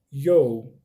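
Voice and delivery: teacher drilling the contraction; pronunciation demo. Shown as a demonstration. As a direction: repeat "you" for learners. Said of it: "vira you".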